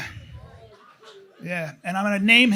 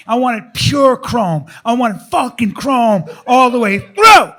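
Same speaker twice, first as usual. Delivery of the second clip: ordinary talk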